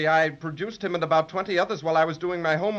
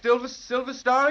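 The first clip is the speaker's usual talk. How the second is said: usual speaking voice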